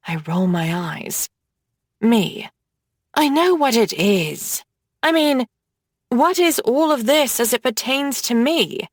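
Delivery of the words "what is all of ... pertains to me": usual speaking voice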